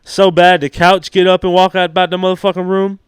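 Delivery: loud, high rowdy crowd work; comic voice. Acted. Comic loudly hitting the crowd with a raunchy bit. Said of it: "that would kill".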